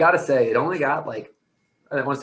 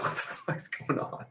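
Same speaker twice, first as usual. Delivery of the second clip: slow, hushed mock disbelief; bewildered whisper; 11 dB quieter, slower than usual. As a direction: whisper it as a stunned aside. Retold bewildered reaction to daughter.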